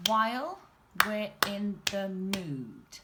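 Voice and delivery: syllable beats. Shown as a demonstration. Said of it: "let's find out our next one".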